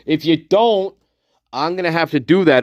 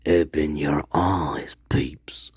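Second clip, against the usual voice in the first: hushed, slow secret whisper, lower; 9 semitones lower, 5 dB quieter, slower than usual. Shown as a demonstration. Streamer whispers a wake-up call to his viewers.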